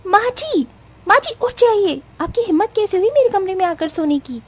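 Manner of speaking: high, angry scolding of the mother-in-law; furious bride. Acted. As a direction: wake her up in a rage. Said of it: "गुस्से के मारे आग बबूला हो जाती है".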